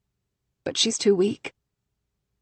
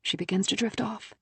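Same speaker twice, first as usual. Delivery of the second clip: ordinary delivery, nothing marked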